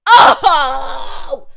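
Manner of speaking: loud burst of laughing shock; shocked laughing shout. Real, not acted. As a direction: blurt it out, laughing in disbelief.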